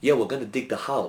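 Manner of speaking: soft, botched Australian sliding into South African; quiet kangaroo with a South African twang; South African-sounding accent. Acted. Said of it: "He's definitely from South Africa now".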